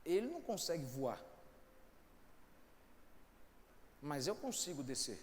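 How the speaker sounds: quiet, reflective recital; soft poetic opening. Acted. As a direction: speak it low like a parable. Pastor opens with soft poetic recitation. (recitação suave e reflexiva)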